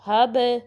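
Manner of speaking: हब with the b released in a small echo; loud हब with rebounding end; qalqalah bounce. Shown as a demonstration. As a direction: bounce off the final b of हब. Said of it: "जिन पे कलकला होता है".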